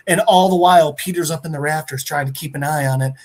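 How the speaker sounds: normal talking voice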